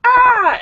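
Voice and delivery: high, loud imitated kiddie scream; kids' scream. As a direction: squeal like startled little kids. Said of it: "the little kids going".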